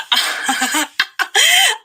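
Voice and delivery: villain's high gloating laugh; high gleeful cackle. Acted.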